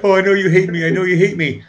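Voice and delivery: mildly hammed-up girlfriend's anguished outburst; imitating distraught girlfriend. Acting out girlfriend's distressed confession.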